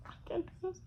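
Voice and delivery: small, high, very soft whine; tiny high voice of giving up; miserable whine. Retold or imitated. Imagining herself stuck cooking desserts, miserable whine. Voicing her own misery at baking.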